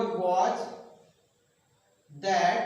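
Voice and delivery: normal talking voice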